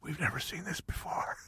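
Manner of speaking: imitating doctors' hushed whisper. Orthopedic surgeon conferring with colleagues over strange injury, whispering. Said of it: "they're all whispering".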